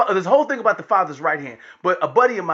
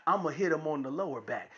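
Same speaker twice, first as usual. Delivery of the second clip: low, soft setup line; understated announcement; 5 semitones lower, 11 dB quieter, faster than usual. Retold or imitated. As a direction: keep it hushed and low. Father's calm setup, against daughter's reply.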